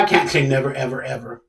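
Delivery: ordinary delivery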